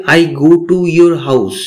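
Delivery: very slow, each word spaced out for learners; teacher says it slowly so students can copy; slow English model sentence. Shown as a demonstration. Teacher models the English slowly for beginners. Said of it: "এটার ইংরেজি তাহলে কি হবে".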